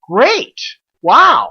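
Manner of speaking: amazed delight. Retold or imitated. Finding shares in birthday card.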